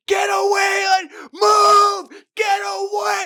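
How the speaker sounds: very high, loud panicked shouts; mock yelling crowd. Bystanders yelling at the woman by the bull, panicked shouts. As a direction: scream a warning to clear out. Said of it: "they're like".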